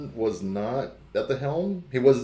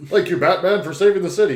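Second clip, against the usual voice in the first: louder, higher mock thanks; grateful citizen; 5 semitones higher, 7 dB louder, faster than usual. Citizen thanks Batman for saving the city, hammed-up.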